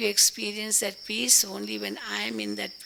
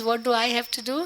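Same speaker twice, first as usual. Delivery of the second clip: ordinary talk, nothing marked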